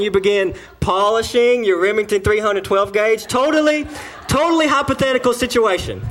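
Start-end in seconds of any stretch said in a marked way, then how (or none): 3.3-6.0 emphatic joking shout. Real, not acted. Joking about the shotgun.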